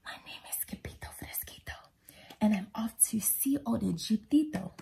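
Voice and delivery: hushed whisper; very soft, secretive chant. A narrator's reading. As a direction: whisper the little rhyme softly and rhythmically. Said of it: "began to sing in a muy".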